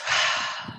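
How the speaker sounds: soft audible sigh out; easy, breath-only exhale; releasing breath. Shown as a demonstration. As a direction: let all the air go without pushing. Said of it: "the release is not a push".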